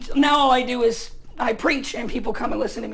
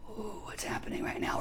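breathless, barely voiced "ooh"; uneasy hushed aside; nervous whisper; 3 semitones lower, 14 dB quieter than usual. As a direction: whisper it like you've sensed trouble. Walking into a tense room.

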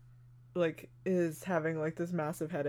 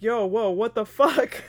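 loud, higher startled reaction; mock alarm; 5 semitones higher, 8 dB louder than usual. Retelling the doctor halting the stool swing, mock alarm. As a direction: blurt it as if stopping someone. Retold doctor stopping a smash.

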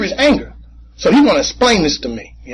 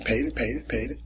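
fading repetition; 6 semitones lower, 15 dB quieter than usual. Real, not acted. Repeated paying, fading.

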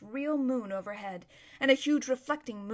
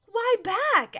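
high, loud startled question; incredulous; 11 semitones higher, 8 dB louder than usual. Acted. Erebus questioning her friend's plan to go back, sharply incredulous. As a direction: pounce on it in disbelief.

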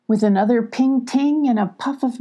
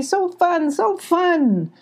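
excited; 10 semitones higher, much slower than usual. Acted. Spunky voiced, excited.

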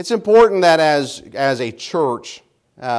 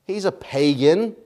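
ordinary delivery

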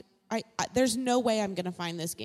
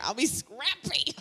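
ordinary talk, nothing marked

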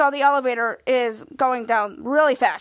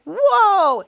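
loud, high whoa; acted amazement; 6 semitones higher, 6 dB louder than usual. Ray reacts to Henry's elevator story, amazed. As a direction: exclaim it in big play-acted awe.

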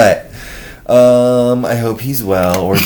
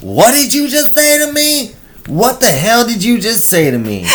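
mock confrontational yell, much higher; angry stranger; 11 semitones higher than usual. Woman from across the street confronting them, mock indignant. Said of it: "is like".

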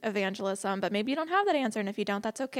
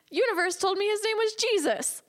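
high-pitched imitation of a thrilled friend; amazed excitement; 10 semitones higher than usual. Friend reveals the universe's name, amazed and excited. Retells friend's big revelation.

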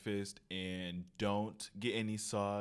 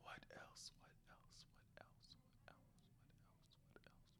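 breathy ASMR whisper into the mic; soft ASMR murmur; 30 dB quieter than usual. Shown as a demonstration. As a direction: whisper it close like an ASMR video. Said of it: "That was A S M R".

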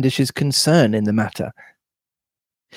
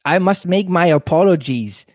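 higher-pitched European mad scientist voice; European accent; 5 semitones higher than usual. Acted. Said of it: "European mad scientist".

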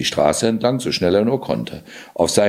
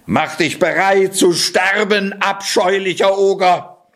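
battle cry; 11 semitones higher than usual. Acted. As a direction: cry out as you draw the sword. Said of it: "rief der Kater".

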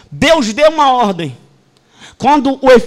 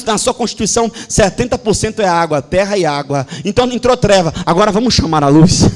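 ordinary delivery

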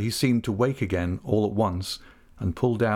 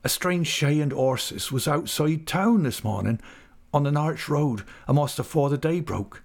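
higher rustic voice, breaking bad news; troubled countryman, pitched up; troubled Ham; 5 semitones higher than usual. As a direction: report it uneasily in country dialect.

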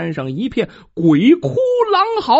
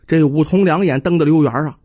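ordinary delivery

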